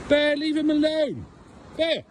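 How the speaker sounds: high sing-song warning; calling the dog off. Real, not acted. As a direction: call it out high across the field. Telling dog off again.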